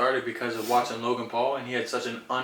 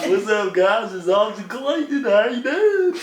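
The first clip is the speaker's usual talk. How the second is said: loud, high hyped vlog greeting; YouTuber intro voice; 9 semitones higher, 9 dB louder, slower than usual. Pretend. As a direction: pump it up like opening a vlog. Mock YouTuber intro to camera.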